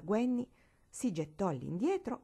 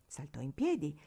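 ordinary delivery

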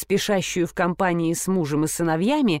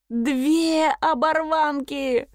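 high, slow, drawn-out moan; 7 semitones higher, much slower than usual. A narrator's reading. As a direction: wail it slowly in a high voice. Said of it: "простонала Нина". (высокий протяжный стон)

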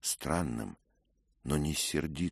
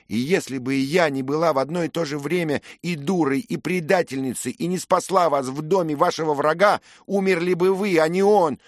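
higher, louder accusing rant; furious young lady; 14 dB louder than usual. A narrator's reading. Grieving lady accusing Dick of killing her kinsman, furious. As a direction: hurl every accusation at the knight.

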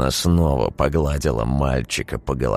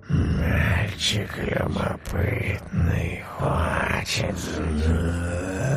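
slow, rustling creature whisper; creepy whisper; 5 dB quieter, much slower than usual. A narrator's reading. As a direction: hiss it out like a lurking creature. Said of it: "прошелестело жутким шёпотом существо".

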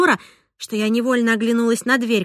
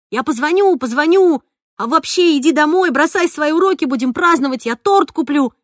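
hurried excitement; 5 semitones higher, 5 dB louder than usual. A narrator's reading. Excited father rushing off.

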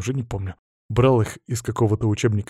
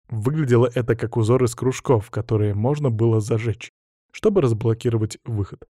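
ordinary delivery, nothing marked

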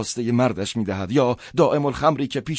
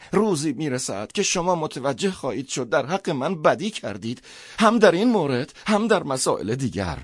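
higher, reproachful priest voice; wronged priest; 5 semitones higher than usual. Acted. Priest tells Schnier he has misjudged him, reproachful. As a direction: plead like a wronged clergyman, pitch raised.

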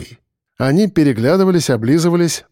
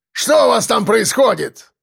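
high, shouted question to men out of sight; roaring demand; 8 semitones higher than usual. A narrator's reading. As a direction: yell across to your men, demanding answers. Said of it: "взревел Кирпич".

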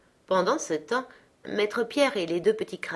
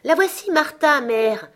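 put-on higher, louder eager girl's voice; young girl crying out; 8 semitones higher, 7 dB louder than usual. A narrator's reading. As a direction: call out brightly as the arriving daughter. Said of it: "s'écria une jeune fille". (jeune fille qui s'écrie, plus aiguë et plus forte)

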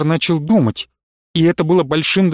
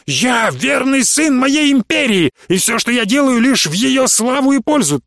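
righteous indignation; 8 semitones higher than usual. A narrator's reading. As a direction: drag it out, wounded and furious. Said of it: "вспылил Прыгунов в праведном негодовании".

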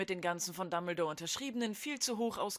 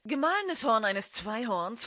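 usual speaking voice

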